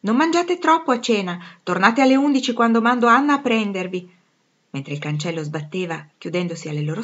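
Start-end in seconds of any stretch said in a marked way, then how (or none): 0.0-4.2 raised, higher motherly call; higher voice carrying down the path; calling after them. A narrator's reading. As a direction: call instructions after departing daughters. Mother calls after departing daughters.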